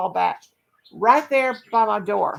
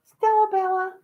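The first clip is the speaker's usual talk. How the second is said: high singsong name call; pet-calling voice; 11 semitones higher, 4 dB louder than usual. Real, not acted. Owner calling her dog for a video, high singsong. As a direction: sing the dog's name up high.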